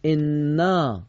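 انا read slowly, every sound held; drawn-out example word. Shown as a demonstration. Teacher models انا slowly for the class. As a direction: stretch out انا as a model reading. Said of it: "مثلاً نگاه کنید".

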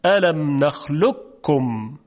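الم نخلقکم slow with ق fully merged, doubled plain ک; full assimilation demo; full merger. Shown as a demonstration. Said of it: "ادغام تامه".

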